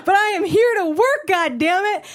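high-pitched, strained resolve; mock defiance. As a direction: declare it bravely despite the fear. Defiant reply in retold scene.

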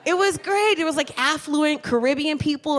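ordinary talk

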